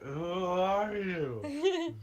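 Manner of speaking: put-on very slow, drunkenly slurred; slurred drunk speech. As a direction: drag and slur every word. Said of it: "Like, very slurred".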